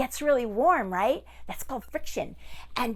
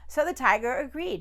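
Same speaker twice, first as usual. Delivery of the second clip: plain reading voice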